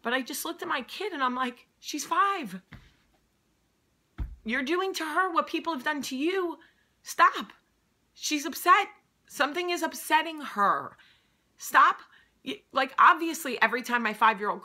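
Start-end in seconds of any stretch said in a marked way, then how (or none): 1.8-12.0 high, loud, urgent inner voice; a voice in her head, loud and sharp; inner voice scolding. Retold or imitated. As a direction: snap at yourself to stop, loud. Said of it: "it was like a voice in my head".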